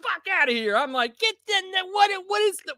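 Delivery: freaked out by the recoil, yelp it; high panicked mock outrage; shocked yelling. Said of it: "I was like".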